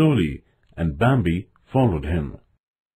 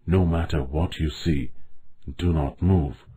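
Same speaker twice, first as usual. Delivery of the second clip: hushed, low warning; soft, low and cautionary; whispered warning; 5 semitones lower, 6 dB quieter than usual. A narrator's reading. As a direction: warn under your breath. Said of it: "the old stag whispered".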